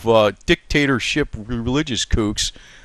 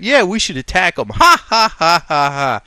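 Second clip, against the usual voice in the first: high, louder gloating laugh in a put-on voice; mocking her laugh; 4 semitones higher, 4 dB louder than usual. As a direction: mimic her laughing off an attack. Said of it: "And she's laughing at".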